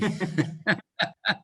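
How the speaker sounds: a short burst of real laughter at his own joke; laughing after joking about raising hands; amused laugh. Real, not acted. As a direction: laugh it off lightly.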